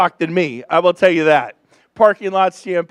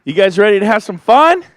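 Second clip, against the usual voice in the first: loud hype call to the room; shout to the crowd; 9 dB louder than usual. Real, not acted. Host rousing the crowd, shouting. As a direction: rev up the audience.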